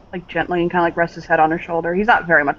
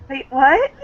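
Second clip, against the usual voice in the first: higher, quick confused question; startled; 6 semitones higher than usual. Acted. Daughter hearing her boyfriend's surprise, startled. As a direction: caught off guard, blurt it out.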